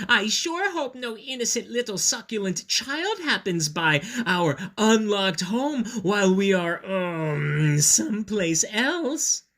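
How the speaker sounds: high, sly, falsely innocent voice; hint at the plot while pretending innocence; scheming Mama Dinosaur. Mother dinosaur hinting at trapping a child, sly high voice. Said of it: "continued Mama Dinosaur".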